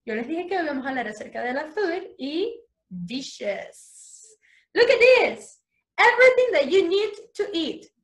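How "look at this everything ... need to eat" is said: put-on higher, louder and bright-eyed for kids; excited. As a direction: sell it to the children with big energy. Teacher excites kids about dishes.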